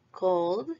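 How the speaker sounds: "called" as one syllable, "cawld"; voiced final D. Shown as a demonstration. Teacher says repeat after me, voiced final D. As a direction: end "called" on a vibrating d, no e. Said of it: "es con vibración".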